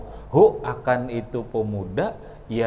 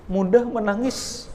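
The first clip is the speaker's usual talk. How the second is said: raised emphasis; 9 semitones higher than usual. Shown as a demonstration. Preacher stressing that Umar cries easily, raised voice. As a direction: lift "mudah menangis" high and strong.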